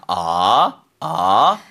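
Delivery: "아" rising from low up to mid; Mandarin second tone. Shown as a demonstration. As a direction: slide "아" upward like a question. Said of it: "이성은 궁금해서".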